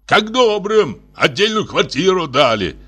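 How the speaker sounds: high-pitched ranting; father yelling from another room; senseless shouting. A narrator's reading. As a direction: cry it out in despair. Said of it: "беспысленно кричал он оттуда".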